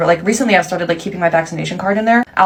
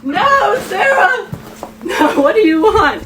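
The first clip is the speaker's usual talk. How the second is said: high-pitched hammed-up shouting; desperate outcry; 12 semitones higher, slower than usual. Confronting Sarah over the worm, desperate shout. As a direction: cry out in melodramatic despair.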